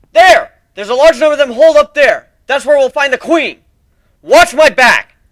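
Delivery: loud high battle cry; battle shout. Acted. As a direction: yell it across the battlefield. Calling out in battle.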